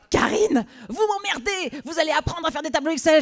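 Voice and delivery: high, loud scolding through a laugh. Retold or imitated. Boss laughing as he scolds the temp. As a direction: scold her while cracking up.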